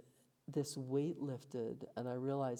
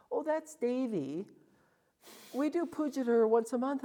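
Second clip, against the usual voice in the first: a hint of a different man explaining the shrine, higher pitched; the local man; 10 semitones higher, 7 dB louder than usual.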